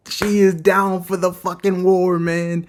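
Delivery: high, drawn-out excited holler; hyped exclamation. Real, not acted. Reviewer thrilled that she is down for war, hyped. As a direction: stretch it out high and loud, thrilled. Narrator hyped by warlike character.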